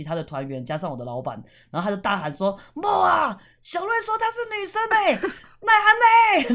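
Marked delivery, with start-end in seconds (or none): 2.7-6.5 high, loud yelled call, the last words dragged slowly; an uncle bellowing across the group, pitch way up; mock shout. An uncle yelling down the tour group to his wife at the back, a high loud shout. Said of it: "喊得很大声".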